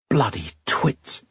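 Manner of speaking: nervous. A narrator's reading. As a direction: mutter it under your breath, on edge. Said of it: "Eyre said nervously".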